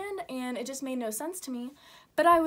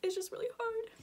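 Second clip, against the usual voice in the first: high, tearful and broken; choking up while speaking; crying; 9 semitones higher, 5 dB quieter than usual. Real, not acted. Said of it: "I don't know why I'm crying".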